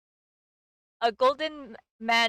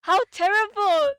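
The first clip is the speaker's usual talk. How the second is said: high, loud dismayed exclamation; shocked exclamation; 9 semitones higher, 9 dB louder than usual. A narrator's reading. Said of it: "exclaimed Cecile".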